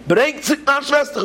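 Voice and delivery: high desperate scream; screaming child. Retold or imitated. Boy screams to the officers for his sister, high desperate scream. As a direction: cry out with everything for your sister. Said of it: "und ich schrie in Keulis".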